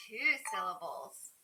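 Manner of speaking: normal talking voice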